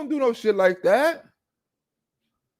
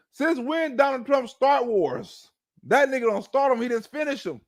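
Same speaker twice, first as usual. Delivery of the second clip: ordinary delivery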